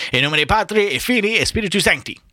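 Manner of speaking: high, intoned mock-priest blessing; Latin blessing. A narrator's reading. Mulligan dishing out eggs, intoning a mock blessing. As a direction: chant it like a priest.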